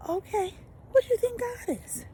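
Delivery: ordinary delivery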